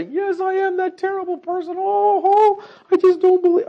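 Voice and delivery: high, loud whiny self-pity; mock groveling penitent. Preacher mocking a groveling sinner, high and whiny. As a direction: ham up a wretched groveling sinner. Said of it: "I don't mean grovel in the ground and say".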